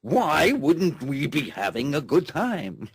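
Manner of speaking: high, bright mocking quote of callers. Host mimics Skype callers claiming a good time, high. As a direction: play the giddy Skype callers, pitched well up. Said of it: "they're Skyping in here saying".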